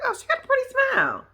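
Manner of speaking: very high, quick coo; retold delighted reaction; gushing admiration. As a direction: squeal a compliment in a rush. Retells own admiring reaction.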